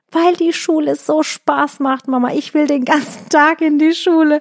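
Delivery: put-on eager little girl. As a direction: play the child who loves school.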